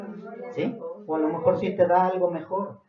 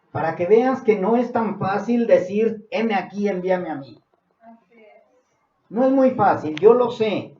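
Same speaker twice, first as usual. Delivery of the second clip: raised, higher pulpit voice; fervent preaching; 6 semitones higher, 6 dB louder than usual. Real, not acted. Preacher urging believers to long to serve the Lord, fervent. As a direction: build with loud, emphatic repeated phrases.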